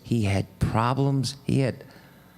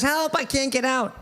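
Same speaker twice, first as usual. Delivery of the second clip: sustained high cry for help; mock scream; 12 semitones higher than usual. Trapped man calls up once more from the well, mock screaming. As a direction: call high out of a well, without real volume. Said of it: "hears him screaming".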